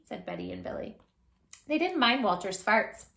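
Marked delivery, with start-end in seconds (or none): none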